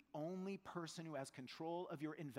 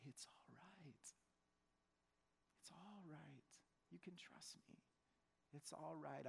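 slow hushed whisper, very soft; soothing whisper; 10 dB quieter, slower than usual. Acted. Holy Spirit reassuring the worried giver, slow soft whisper. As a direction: breathe it out slowly to calm a frightened friend. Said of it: "the Holy Spirit is like".

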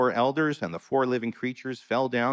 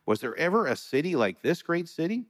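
exclaimed question, pitch swinging much higher; exclaiming; 8 semitones higher than usual. A narrator's reading. Onlookers watch the great city burn, exclaiming. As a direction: mildly exclaim it, high-pitched, as a reader. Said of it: "they will exclaim".

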